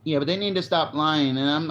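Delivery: ordinary talk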